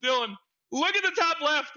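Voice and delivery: high, loud laughing urgency; telling teammate Dylan to check the order; urgent call. Retold or imitated. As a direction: point out the obvious, loud and amused. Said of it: "I said".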